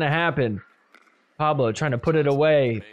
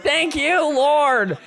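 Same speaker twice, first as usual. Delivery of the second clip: mock prayer; 12 semitones higher, much slower than usual. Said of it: "He's like".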